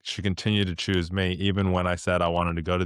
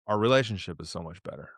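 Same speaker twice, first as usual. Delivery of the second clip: ordinary delivery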